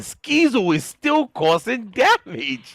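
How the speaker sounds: high, loud, drawn-out esports hype; real high-pitched shouting, words stretched out; excited commentator shout. Real, not acted. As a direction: erupt in amazement at the play. Caster erupts at a player's damage.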